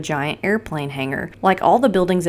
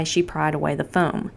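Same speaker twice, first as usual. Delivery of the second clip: ordinary delivery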